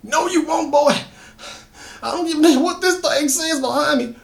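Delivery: cling on though terrified; distraught crying. Acted. Drummond defying Solomon, high and distraught. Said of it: "clearly distraught and crying".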